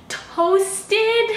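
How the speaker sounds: high, loud shocked echo. Acted. Customer stunned at being asked about toast, high and loud. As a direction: gasp the word out in disbelief.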